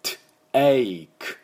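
"トゥエイク" slowly, T burst apart from "eik"; split-up pronunciation. Shown as a demonstration. Teacher correcting a take-as-タケ reading, "トゥエイク" slowly split into sounds. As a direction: sound out "トゥエイク" piece by piece, long "ei". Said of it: "だから、タケではなくて".

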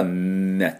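long hummed N released into ね; held N sound. Shown as a demonstration. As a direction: hold the N before ね. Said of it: "Nはねって音のね". (「ん」をためてから「ね」)

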